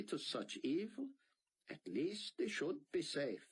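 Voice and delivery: a hint of a thick Israeli accent. A narrator's reading. Sion reassuring Buck, Israeli accent. Sion's voice continues.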